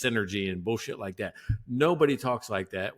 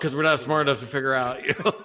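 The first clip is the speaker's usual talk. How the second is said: laughing; 5 semitones higher, 5 dB louder, faster than usual. Real, not acted. Repeating the joke while laughing.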